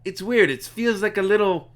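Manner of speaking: high, hesitant character voice; Gale. Acted. Game character puzzling over strange energy, high hesitant voice. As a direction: feel for the words in a high role voice. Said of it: "Gale also says".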